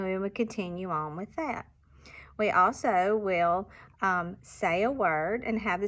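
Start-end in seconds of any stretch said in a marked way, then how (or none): none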